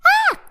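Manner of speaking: sudden high, loud scream; high startled yell; shout. A narrator's reading. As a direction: shriek out in fright, loud and short. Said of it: "starting to shout".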